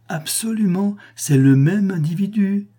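high, excited declaration; ringing, high-pitched certainty; emphatic confirmation. A narrator's reading.

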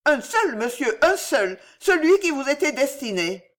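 high, protesting confession; defensive old woman. A narrator's reading. Old woman admits reading one letter, defensive and high.

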